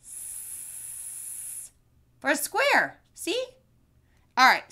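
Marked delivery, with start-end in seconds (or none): 0.0-1.7 a long unvoiced S hiss with a smile; S held like a snake. Shown as a demonstration. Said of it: "The letter S makes that sound".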